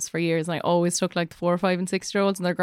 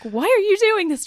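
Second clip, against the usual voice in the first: high-pitched exasperated teacher; 11 semitones higher than usual. Retold or imitated. Teacher shows hosts how her classroom voice slips out, teacher voice. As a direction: demand an explanation, pitched well up. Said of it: "the teacher voice is a big thing that comes out of me".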